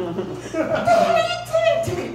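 very high yowling cat complaint; shrill mock cat wail; cat voice, shriller. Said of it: "you get certain sensation like".

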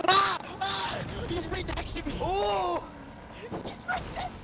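high-pitched startled yelps and cries; startled panic. Real, not acted. Player spots the giant right next to him, panicked. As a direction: cry out in sudden alarm, voice jumping up. Startled by nearby monster in game.

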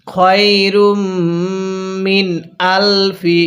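slow chant of খয়রুম মিন আলফি শাহর; tajweed chant; slow recitation. Shown as a demonstration. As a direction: draw out each word as in Quran reading. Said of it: "হ্যাঁ পড়ুন".

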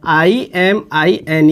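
I-M-I-N spelled slowly letter by letter. Shown as a demonstration. Chemistry teacher spelling the name for students, slow and clear. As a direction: spell आईएमआईएन out slowly, one letter at a time. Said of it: "इमीन कैसे हो या इमाइन कह दो".

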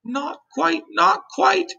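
high-pitched, gentle lady's voice; kind ladybug voice. A narrator's reading. Ladybug standing beside James to explain, kind high voice. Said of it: "continued the ladybug".

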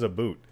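"a boot" with an exaggerated oo for "about"; "a boot", rhyming with "hoot"; mock Canadian pronunciation. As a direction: make fun of "about" by saying "a boot". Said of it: "who the hell says".

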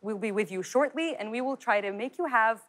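slow American hotline greeting; American accent. Shown as a demonstration. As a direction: play a polished American receptionist. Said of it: "American accent عادي". (لهجة أمريكية بطيئة لموظفة استقبال)

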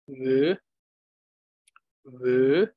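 Vö stretched and wavering; stretched wavy wo. Shown as a demonstration. Teacher demonstrates the stretched wavy vowel to students. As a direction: draw wo out long with a wavy glide. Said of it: "it becomes more stretchy, more wavy".

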